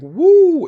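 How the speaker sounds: very high, loud wrestling woo; Ric Flair woo. Shown as a demonstration. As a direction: let out a Ric Flair scream. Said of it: "Ric Flair woo".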